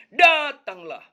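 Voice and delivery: Datanglah called out loud and high; preacher's loud, high Datanglah; stressed Datanglah. Shown as a demonstration. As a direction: ring out Datanglah as the climax. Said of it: "Yesus Tuhan kita".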